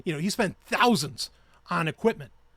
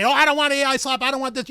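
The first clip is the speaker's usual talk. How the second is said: mocking complainer; 6 semitones higher, 5 dB louder than usual. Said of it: "get all riled up and say".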